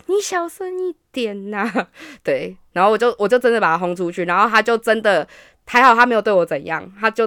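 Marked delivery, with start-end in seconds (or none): 0.0-1.9 softer, slow frightened plea; scared hush. Retold or imitated. The date scared, hushed plea. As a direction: beg for quiet in panic. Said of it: "然后他吓得要死".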